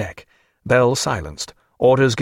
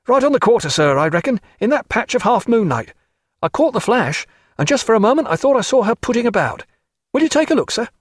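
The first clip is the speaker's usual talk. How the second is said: higher-pitched seaman's voice; eager sailor reporting; 10 semitones higher than usual. A narrator's reading. Tom reporting a ship to his captain, eager and high.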